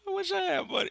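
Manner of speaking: squeaky high mock wail; hammed-up high-pitched whimper; mock whine. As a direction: whine it way up high like a pretend sob. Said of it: "I'm gonna cry now".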